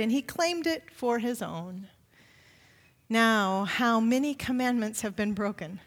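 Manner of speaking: ordinary talk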